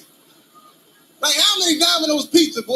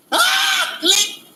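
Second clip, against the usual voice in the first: mock scream and click. Scream then gun click.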